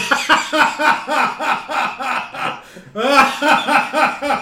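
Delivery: long, loud, high belly laugh; real helpless cackling fit. Real, not acted. Cracking up at karate class.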